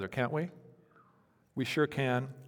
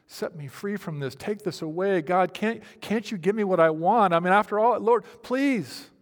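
rising, ever louder desperate plea, higher pitch; pleading cry; 6 semitones higher, 8 dB louder than usual. Retold or imitated. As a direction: start as a pleading prayer and build to a loud cry. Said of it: "You're crying out".

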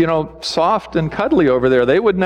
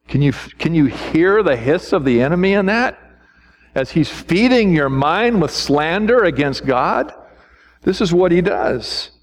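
usual speaking voice

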